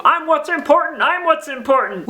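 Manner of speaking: high, attention-grabbing mock voice; clamouring world. Crazy stuff in the world competing for you, clamouring voice. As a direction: act out distractions clamouring for attention. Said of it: "crazy stuff in the world".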